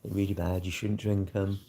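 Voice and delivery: softly voiced nagging viewer, lightly done; a hint of a preachy know-all; mock viewer warning. Imitating a viewer telling him off about sugar-free drinks, softly. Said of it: "I guarantee you're gonna tell me".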